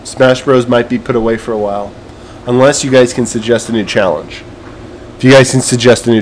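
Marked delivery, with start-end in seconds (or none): none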